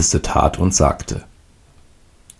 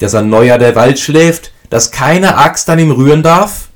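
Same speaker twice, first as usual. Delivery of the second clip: stern mother; 9 semitones higher, 5 dB louder than usual. A narrator's reading. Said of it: "die Mutter vernehmen".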